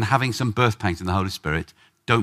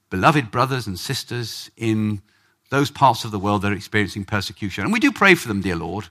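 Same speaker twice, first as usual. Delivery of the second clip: mock-sedate prayer, mostly low and soft; ordered, formal prayer; 5 semitones lower, 3 dB quieter than usual. Preacher imitating a fairly ordered prayer meeting, subdued and formal. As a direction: recite a very proper, restrained prayer. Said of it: "most of our prayer meetings are fairly ordered".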